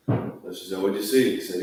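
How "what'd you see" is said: a touch of a curious wife; eager wife. Retold or imitated. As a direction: jump in wanting to know. Wife asks eagerly what he saw.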